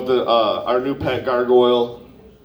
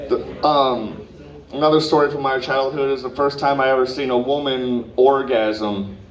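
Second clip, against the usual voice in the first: ordinary delivery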